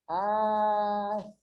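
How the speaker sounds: very slow, long held syllables. Shown as a demonstration. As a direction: split the word into drawn-out syllables. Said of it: "você separa em sílabas". (sílaba por sílaba, bem devagar)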